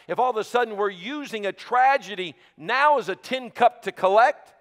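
loud, high, rising sermon climax; full-force preaching. Acted.

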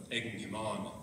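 ordinary talk, nothing marked

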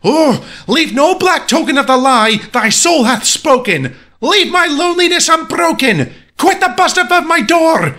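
high, frantic shouted command; theatrical high-pitched rant; shrieking. A narrator's reading. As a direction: scream at the bird to be gone. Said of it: "I shrieked up starting".

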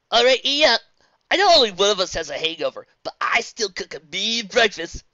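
loud hearty call to breakfast; cheerful call. Acted. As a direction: call them to the table. Cheery cook calling out.

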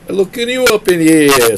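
high, loud hammed-up New Yorker; exaggerated East Coast city accent, New York style. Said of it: "I was trying to go for some, like, New Yorker".

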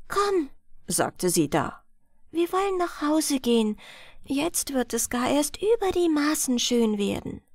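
ordinary delivery

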